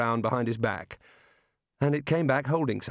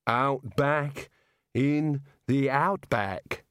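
put-on drunk slur; 3 semitones higher, much slower than usual. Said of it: "staggering forward".

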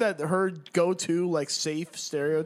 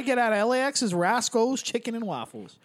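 put-on bright, sing-song North Dakota accent; 4 semitones higher than usual. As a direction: chirp it like a cheerful Midwesterner. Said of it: "North Dakota voice for her".

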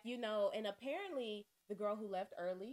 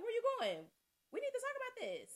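high urgent call after her; 13 semitones higher, 3 dB louder than usual. Retold or imitated. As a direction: shout after someone leaving. Calling after departing friend.

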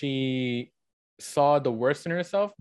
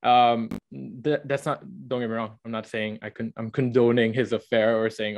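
ordinary talk, nothing marked

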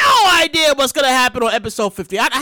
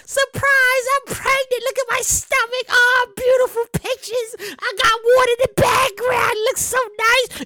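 high, sing-song mock celebrity announcement; 9 semitones higher, slower than usual. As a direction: chirp it like a coy influencer. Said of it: "We get the".

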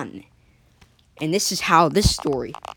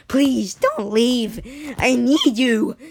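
begging; 6 semitones higher, 4 dB louder, much slower than usual. Retold or imitated. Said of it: "begging him to just be like".

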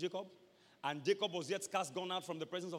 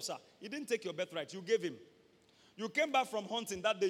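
usual speaking voice

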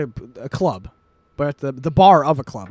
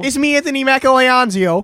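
loud, high, hammed-up Italian accent; a cartoon Italian guy shouting his name; mock Italian; 10 semitones higher, 7 dB louder than usual. Friends joke the actor as Italian, loud mock Italian accent. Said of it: "if he was an Italian guy".

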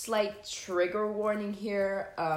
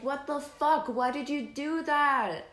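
high, drawn-out mock yell; exasperated retold yell, not full volume; 8 semitones higher than usual. Girlfriend yelling at boyfriend outside the room, mock yell. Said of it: "yelling at him".

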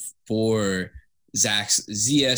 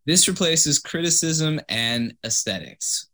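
mock dramatic reading; 6 semitones higher than usual. Shown as a demonstration.

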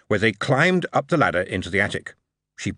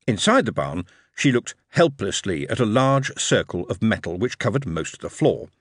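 ordinary delivery, nothing marked

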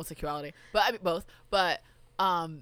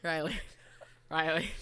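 laughing; 4 dB louder than usual. Real, not acted. Laughing call of name.

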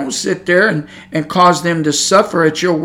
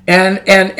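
ordinary talk, nothing marked

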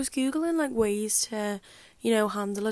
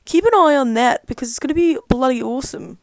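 louder, bouncy hype for her new clothes; hyped-up promo; 3 semitones higher, 9 dB louder than usual. Real, not acted. Promoting her embroidery shop to listeners, loud and hyped. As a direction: oversell it like an advert, then cringe. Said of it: "Ew, as if I just said awesome".

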